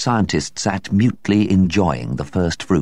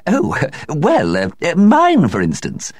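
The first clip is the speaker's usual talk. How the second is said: high, flustered and hesitant; high embarrassed stammer; feeble laugh; 12 semitones higher, 5 dB louder, slower than usual. A narrator's reading. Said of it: "with a feeble laugh".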